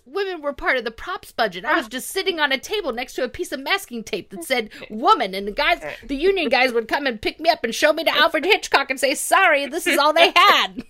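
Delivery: Debet voice. Retold or imitated. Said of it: "then she says".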